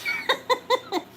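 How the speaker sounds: high-pitched burst of giggles; laughing. Real, not acted. Seller amused after correcting the brand to Joan Rivers, laughing. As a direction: break into a quick, high laugh.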